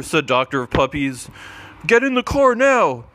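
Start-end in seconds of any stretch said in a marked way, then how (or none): 1.9-3.0 high, loud urgent order; urgent shout. Acted. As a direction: bark it out in a panic. Fleeing robot lasers.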